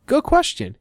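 high-pitched playful quote; mock acted reply. Iron Man answering the crowd, high-pitched mock reply.

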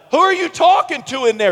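high, loud wifely call; puzzled wife. Retold or imitated. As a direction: call out the question, pitched well up. Wife calls to him in shower.

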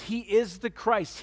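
normal talking voice

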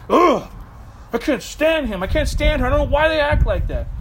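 mock exasperation, griping about someone; frustrated rant. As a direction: vent like a frustrated spouse, half-acting it. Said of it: "get super frustrated".

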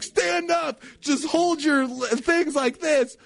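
high mock mom insisting; insistent mom. Mom telling her son to stand up, imitated high. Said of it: "she's like".

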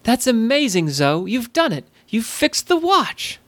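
high-pitched excited praise, climbing at the end; delighted amazement. Acted. Alyssa praising Zoe for fixing the watch, delighted.

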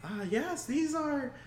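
high, slow, proud introduction; cheerful NPC voice. Acted. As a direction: show them off proudly.

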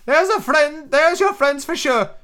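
put-on high, excitable old castaway; squeaky marooned sailor, eager and odd; lightly cracked high pirate voice; Ben Gunn voice. Ben Gunn's voice in the story.

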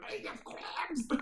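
high, garbled duck voice; rough attempt at duck talk; Donald Duck. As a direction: squeak it out like a cartoon duck. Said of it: "My Donald Duck is just".

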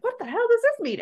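mock bafflement. Voicing people's reaction to a passing fad, mock bafflement. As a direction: jump way up in pitch, bewildered. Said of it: "and, and you're like".

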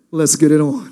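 low, crooning lover's voice; smooth seduction voice. Acted. Preacher mocks the brother's come-on, low and smooth. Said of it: "three hundred way to say".

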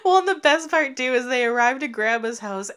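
normal talking voice

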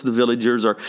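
usual speaking voice

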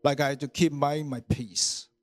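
ordinary talk, nothing marked

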